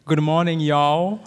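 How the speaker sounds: novice attempt at a Southern accent. Shown as a demonstration. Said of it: "my attempt of spe-, speaking Southern".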